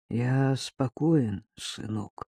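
soft, slow sigh of relief; exhaled with relief. Acted. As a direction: breathe the words out slowly. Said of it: "выдохнул он".

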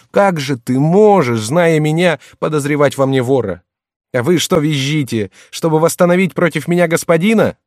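high, loud, wounded protest; impassioned, hurt shouting; aggrieved cry. A narrator's reading. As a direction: cry out the unbearable injustice, building. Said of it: "закричал голосом, полным нестерпимой обиды".

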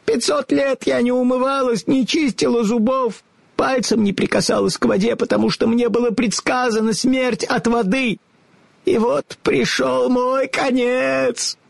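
put-on high, lamenting old witch voice; wailing witch. A narrator's reading. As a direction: wail out her doom. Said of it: "завыла старуха".

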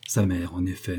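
ordinary delivery

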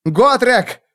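shouted name, high and loud; shouting. Acted. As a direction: call out urgently across the fight. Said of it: "крикнул Феликс".

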